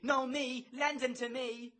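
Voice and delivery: high falsetto demand; clamouring Kitty. A narrator's reading. Kitty wants her turn at Bloom, clamouring high.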